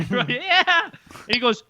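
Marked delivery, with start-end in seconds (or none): 0.3-0.6 high eager mock reply; imitated excited yes; eager agreement. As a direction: chime in high and keen. Retold eager group reply.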